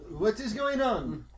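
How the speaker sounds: high, drawn-out yell at ordinary volume; mock yell. Jacques yelling in French in the chaos, high mock yell. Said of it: "Jacques yells in French".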